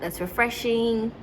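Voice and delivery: normal talking voice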